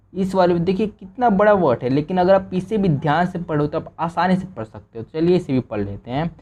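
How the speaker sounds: ordinary delivery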